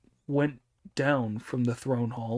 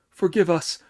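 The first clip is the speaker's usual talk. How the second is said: louder, higher frightened plea; pleading; 4 semitones higher, 5 dB louder than usual. Acted. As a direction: beg for mercy on your knees. Terrified officers plead.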